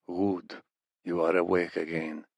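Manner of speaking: a hint of a cold interrogator; menacing captor. A narrator's reading. Reaper captor voiced in story.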